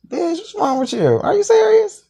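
can't believe what you're hearing; high-pitched, indignant disbelief; outraged disbelief. Retold or imitated.

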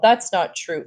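plain reading voice

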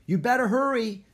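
high, loud, urging; pressing holler from a small kid; small girl's yell. A narrator's reading. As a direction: yell it like a little girl. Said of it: "yelled a small girl".

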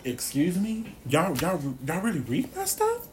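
playful "excuse me?"; lightly voiced disbelief; mock surprise. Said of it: "So I was like".

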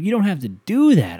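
loud, high-pitched mock pleading; exasperated protest. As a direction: shoot up high in comic disbelief. Objectors' last protest.

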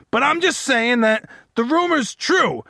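ordinary delivery

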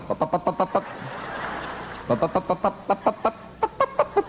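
high, soft mock chatter; wife chattering like a parrot. Husband mimics wife's endless chatter on the drive, high and soft. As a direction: rattle on like a nonstop parrot.

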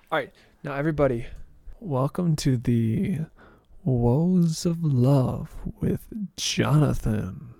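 loud, slow host intro; show announcer. Acted.

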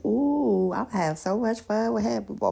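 ordinary delivery

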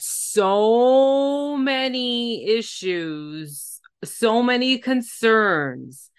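drawn-out disbelief. Real, not acted. Stretching words in disbelief.

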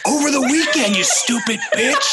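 finally explode, hammed up; mock Chris Farley snap. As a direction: guest fed up with questions, mock furious. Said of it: "Chris Farley's had enough".

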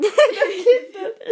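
loud laughing fit; burst of laughter. Real, not acted. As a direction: laugh hard. Laughing at being tickled.

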